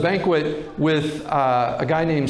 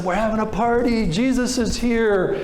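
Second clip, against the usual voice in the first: higher, bright joyful announcement; joyful welcome; 7 semitones higher than usual. Retold or imitated. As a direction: announce the party like a happy host. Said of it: "welcomed Jesus joyfully".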